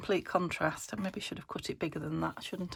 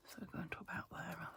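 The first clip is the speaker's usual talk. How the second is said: whispered aside; 15 dB quieter than usual. Real, not acted. Quiet aside while cutting.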